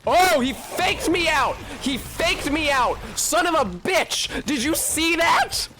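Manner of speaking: high, slowed, shouting in disbelief; outraged yelling. Real, not acted. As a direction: yell at the screen, stunned. Outraged at enemy faking attack.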